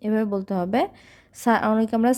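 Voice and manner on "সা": normal talking voice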